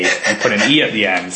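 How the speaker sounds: normal talking voice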